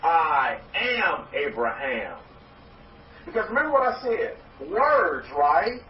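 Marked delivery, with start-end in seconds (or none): 0.0-2.2 slow, deliberate chant of I am Abraham; slow affirmation. Shown as a demonstration. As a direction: lean on am, drawn out like a creed. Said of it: "Say it right now".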